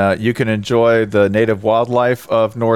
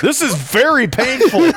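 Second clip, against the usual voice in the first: high whiny voice; 13 semitones higher than usual. Pretend. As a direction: whine it up high. Voicing the struck player.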